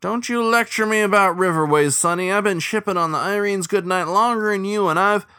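indignant lecture. A narrator's reading. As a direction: put the young captain in his place. Old cook lecturing captain.

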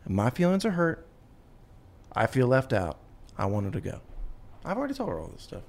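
usual speaking voice

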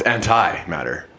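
"antimatter" said slowly as AN-tie-matter; an-tie pronunciation. Shown as a demonstration. Correcting a friend's reading, "antimatter" as an-tie-matter. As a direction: sound out "antimatter" with a long eye. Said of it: "Sound it out".

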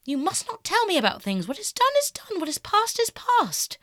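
high, loud insistence; agitated protest. A narrator's reading. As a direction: shut the subject down forcefully. Dorian protests in the quarrel.

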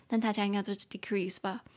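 ordinary talk

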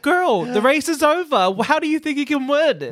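high-pitched incredulous retort; mock disbelief. Talking sense into a losing triathlete, mock disbelief. Acted disbelieving reply to a contestant.

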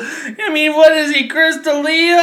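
high-pitched crying with laughter; laughing hysterically. Real, not acted. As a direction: laugh till it hurts. Helpless laughter telling the story.

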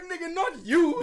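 amused disbelief. Retold or imitated. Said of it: "I was like".

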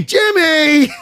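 loud, high shout of the name Jimmy; mock Matt Serra yelling Jimmy; Matt's Jimmy call. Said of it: "I like your impersonation of me".

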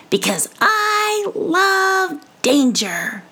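high, slow, swaggering squeak; boastful mouse. Acted. Mouse bragging to the old woman, slow high swagger. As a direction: puff up like a tiny hero. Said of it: "Brisbane boasting".

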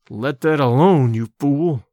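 low, slow growled warning into the ear. Acted. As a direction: growl it low and hard, slowly. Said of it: "I growled in his ear".

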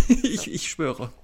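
high, giggling promise; laughing. Real, not acted. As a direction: swear it through giggles. Laughs promising less coffee.

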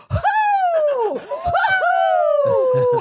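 high, laughing whoops; Kenny impression. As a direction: yell it like a hyped reality star. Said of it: "Best impression of Kenny I've ever heard".

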